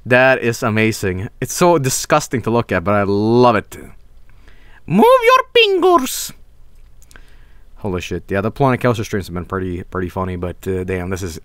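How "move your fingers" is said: high mock voice.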